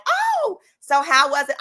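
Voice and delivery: put-on eager girlfriends.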